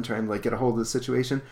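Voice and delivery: ordinary talk, nothing marked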